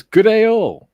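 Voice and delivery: high, loud drawn-out call; big showy hello to the room; booming greeting. Acted. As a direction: belt out a hearty greeting. Booming greeting read from chat.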